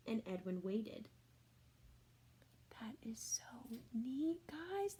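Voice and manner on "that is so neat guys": soft whispered wonder; hushed delighted whisper; awed whisper. Real, not acted. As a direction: breathe it in quiet amazement. Marvelling aside to the children.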